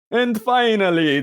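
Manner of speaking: a hint of the Count. Host winding up the plug in a light Count voice. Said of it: "Count Dracula, Sesame Street style".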